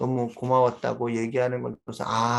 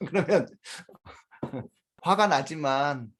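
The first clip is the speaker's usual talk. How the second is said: ordinary talk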